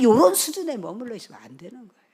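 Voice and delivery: forceful, loud warning from the pulpit; loud admonishment. Real, not acted. Preacher warning congregation not to stay at this level, loud admonishment. Same warning, first hammered, then hushed.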